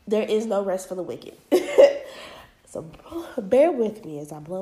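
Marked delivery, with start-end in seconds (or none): 1.4-2.2 quick airy laugh; short breathy laugh. Real, not acted. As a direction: let out a breathy chuckle. Laughs at own joke.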